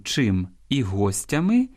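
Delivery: slow, with the stress on the first syllable of "гостями": ГОстями; careful stress demo. Shown as a demonstration. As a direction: spell out "гостями" slowly, stressing ГО. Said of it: "в орудному відмінку". (повільно, з наголосом на першому складі: гОстями)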